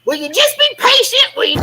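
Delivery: exasperated high-pitched retold snap at Roxanne; impatient snap. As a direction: snap at her to be patient, pitch way up. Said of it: "I'm like".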